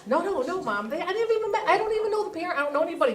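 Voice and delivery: put-on high, soft pleading child; begging not to be embarrassed; pleading kid.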